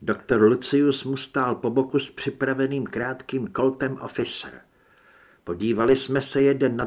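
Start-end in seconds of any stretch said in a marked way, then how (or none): none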